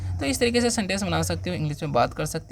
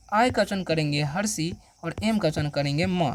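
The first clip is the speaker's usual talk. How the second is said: ordinary delivery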